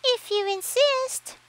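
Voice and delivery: very high, slow chirpy voice; a little bird, chirping. Acted. Sparrow accepts fight.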